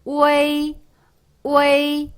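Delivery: 우에이 held long on a high level tone; high, steady 우에이 gliding through e to i; pronunciation demo. Shown as a demonstration. Teacher answering what it becomes, pronunciation demo. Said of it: "이렇게 표기가 되는 거예요".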